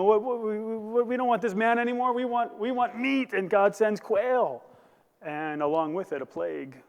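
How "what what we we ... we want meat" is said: higher-pitched, insistent mock complaint; complaining. People of Israel complaining about meat, acted high-pitched grumbling. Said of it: "you have the people complaining".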